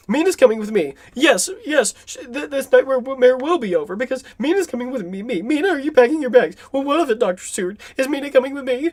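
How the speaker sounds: hammed-up whiny fiancé; mock whining. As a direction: rattle it off in a high, fretful whine. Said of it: "He just sounds whiny".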